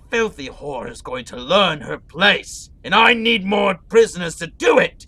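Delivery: loud, building villainous rant that rises to a roar; furious villain order. Acted. Villain lord berating his soldiers over prisoners, raging shout. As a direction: bellow at the soldiers until the voice is climbing high.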